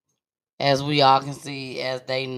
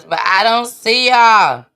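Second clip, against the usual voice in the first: much louder call to the viewers; 6 semitones higher, 12 dB louder than usual. Real, not acted. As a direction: call out over the glitch. Stream glitch, calling to viewers.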